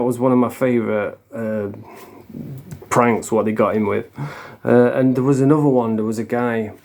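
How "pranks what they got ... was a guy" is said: ordinary talk, nothing marked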